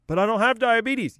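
high, loud mock objection; protesting patient. Patient objecting to insulin advice, high loud mock protest. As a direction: protest indignantly, pitched well up.

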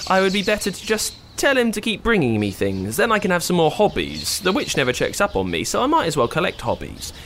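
a hint of a chatty princess; Rapunzel voice. Rapunzel voice in story.

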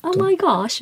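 high, put-on British "Oh my gosh"; playful mock British accent. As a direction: gush it like a British girl. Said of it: "イギリスのアクセントになっちゃうね".

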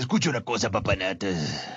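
lightly voiced dubbed movie line; a hint of Latin dubbing; neutral dubbing voice. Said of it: "esas voces que uno oye como en las películas".

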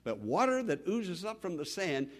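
normal talking voice

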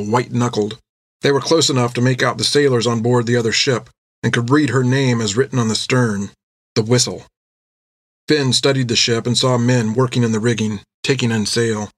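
ordinary delivery, nothing marked

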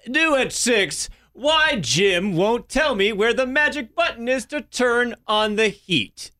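high, slow news anchor read. Retold or imitated. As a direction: announce it like breaking news. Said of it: "in their anchor voice".

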